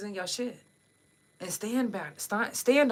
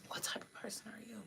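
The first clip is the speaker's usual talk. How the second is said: unvoiced whispered repeat; 2 semitones higher, 15 dB quieter, faster than usual. Real, not acted. Host repeats the question to viewers as a whisper. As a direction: breathe the question out, much softer.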